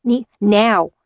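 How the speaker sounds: sharp, loud command; urgent shout. A narrator's reading. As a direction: push her out the door now. Urgent order to flee.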